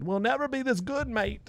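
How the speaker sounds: put-on high, soft, botched British-style accent; high-pitched bad accent impression; terrible fake accent. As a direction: badly attempt a British "mate" accent. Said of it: "I don't even know what accent that was".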